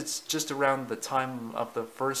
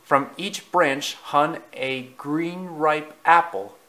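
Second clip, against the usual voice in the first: ordinary delivery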